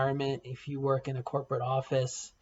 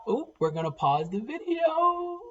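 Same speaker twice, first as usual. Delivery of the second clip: startled exclaim; 13 semitones higher, 4 dB louder than usual. Real, not acted. Interrupted by a phone call.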